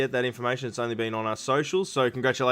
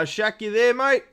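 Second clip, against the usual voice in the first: high, raised call on mate; 8 semitones higher, 4 dB louder, slower than usual. Real, not acted. Host calls out to muted racer Shaq, high raised call. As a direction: call up to him, checking he's there.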